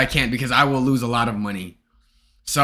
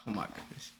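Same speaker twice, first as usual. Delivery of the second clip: relieved whisper; 7 semitones lower, 24 dB quieter than usual. Real, not acted. Streamer whispers to chat with relief, unvoiced.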